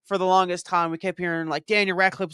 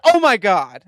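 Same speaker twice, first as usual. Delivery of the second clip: louder and higher, caught off guard; thrilled exclamation; 4 semitones higher, 9 dB louder, slower than usual. Retold or imitated. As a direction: burst out with delight. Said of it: "I literally said that".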